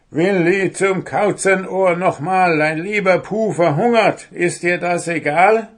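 high, loud exasperated shout; shouting dwarf. Acted. Dwarf shouts at owl that he's starving. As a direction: yell at the sleepy owl. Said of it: "schrie der Zwerg".

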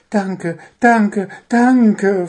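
high, gushing little bird; delighted high-pitched relief; overjoyed thanks. A narrator's reading. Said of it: "freute sich der kleine Vogel".

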